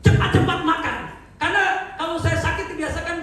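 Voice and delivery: high, loud, driving sermon voice; full-force preaching. Acted.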